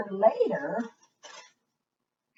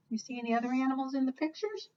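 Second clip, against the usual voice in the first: ordinary delivery, nothing marked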